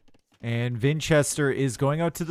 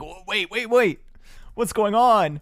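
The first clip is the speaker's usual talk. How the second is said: mock panic; 7 semitones higher, 4 dB louder, slower than usual. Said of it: "He's gonna be like".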